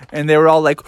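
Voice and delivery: ordinary delivery, nothing marked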